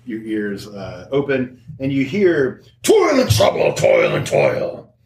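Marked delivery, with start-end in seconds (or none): 2.8-4.9 guttural ogre voice. Acted. Game master voices a distant ogre for the players, guttural chanting. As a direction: bellow like an ogre, dragging out the last words. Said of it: "You hear a deep guttural voice echoing across the land".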